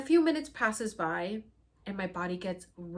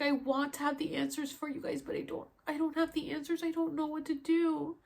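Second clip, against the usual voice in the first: frustrated crying; 8 semitones higher than usual. Retold or imitated. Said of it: "crying because I was so frustrated".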